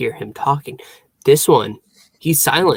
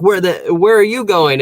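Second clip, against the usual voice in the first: raised, higher demanding question; imitating Vincenzo; 6 semitones higher, 7 dB louder than usual. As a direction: call after him, pushy. Retold Vincenzo calling out.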